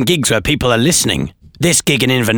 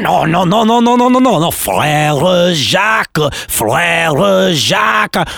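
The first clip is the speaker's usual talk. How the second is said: high, exasperated French officer correcting; French language police; 6 semitones higher, much slower than usual. Acted. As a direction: correct the parrot in hammed-up French, high-pitched. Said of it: "imagine the language police turning up".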